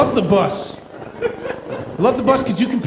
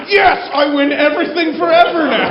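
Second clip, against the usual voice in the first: higher, louder victory cry; triumphant gloating; 5 semitones higher, 3 dB louder than usual. Acted. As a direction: crow like you just won it all. Gloating over the misdirected message.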